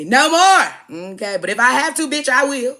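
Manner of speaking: shouted brag; loud defiant. Real, not acted. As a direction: dare him with it. Boasting she'll return to streets.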